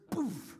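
loud, explosive "poof"; imitated knock-away sound. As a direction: punch out a sudden sound effect. Imitates bar knocked from his hand.

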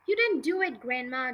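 usual speaking voice

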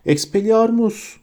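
high, slow cry of the spell; shouted spell. A narrator's reading. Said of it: "diye bağırdı".

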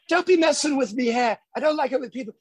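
grumpy rock star, mock Birmingham accent. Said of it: "[name]'s going".

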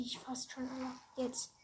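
whispering. Real, not acted. Whispers over loading screen.

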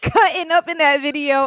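loud, laughing delight. Real, not acted. Friend laughing about a friend cutting up in the video. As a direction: crack up telling it.

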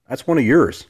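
mock accusing tease; teasing jab. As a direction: needle the women listeners. Teasing the ladies.

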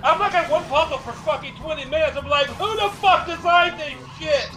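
high-pitched comic outrage; exasperated rant. Retold or imitated. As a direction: retell it in worked-up disbelief. Ranting about puzzles, quoting own outrage.

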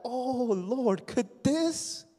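a fervent gasp of recognition; stunned realization. Retold or imitated. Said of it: "I thought".

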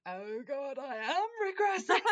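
high, loud hammed-up dismay; mock alarmed realisation. Host retelling reading about regressing, mock alarmed outburst. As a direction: build to a comic wail of self-recognition.

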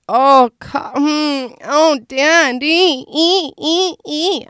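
high, loud mock moaning; drawn-out, hammed-up moans in high pitch; sexual moaning act. Host showing off dirty talk, exaggerated moaning.

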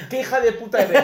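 hammed-up angry yell; retold insult. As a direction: hurl the insult half in jest, replaying the moment. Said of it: "la llamé, cabrona".

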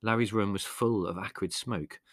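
plain reading voice

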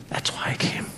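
breathy whisper of "That's why I came". Acted. Jesus whispering his purpose to the disciples. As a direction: drop it to a bare whisper.

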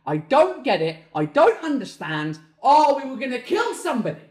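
loud, exasperated outburst; tantrum. Real, not acted. Ranting about French bank hours, exasperated tantrum. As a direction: build from insistent to a high yell. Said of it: "Tantrum over".

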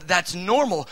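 ordinary delivery

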